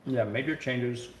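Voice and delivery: normal talking voice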